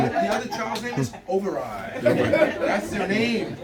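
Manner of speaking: laughing through the punchline. Real, not acted. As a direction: chuckle the name out, slowly. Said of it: "laughing at his own joke".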